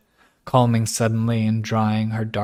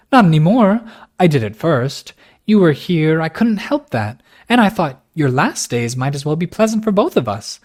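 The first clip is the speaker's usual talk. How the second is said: passionate protest; 5 semitones higher, 5 dB louder than usual. A narrator's reading. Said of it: "she protested passionately".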